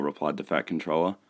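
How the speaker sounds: ordinary delivery, nothing marked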